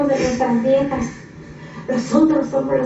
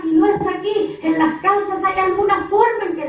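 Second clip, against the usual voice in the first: higher, urgent preacher's exhortation; fervent preaching; 6 semitones higher than usual. Acted. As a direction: call them out of the tent with fire. Preaching God's call at full force.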